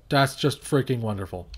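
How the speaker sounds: ordinary talk, nothing marked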